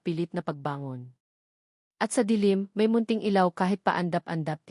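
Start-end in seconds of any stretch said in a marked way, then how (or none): none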